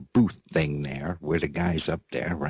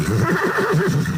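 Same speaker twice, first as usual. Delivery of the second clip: high giggle; 6 dB louder than usual. Real, not acted. Giggle between lines.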